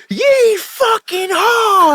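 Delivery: high, slow, stretched-out yeehaw; drawn-out hammed-up cowboy holler; cowboy whoop. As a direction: whoop it like a rowdy cowboy pulling up. Retold cowboy-style arrival in truck.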